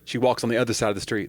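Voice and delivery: ordinary talk, nothing marked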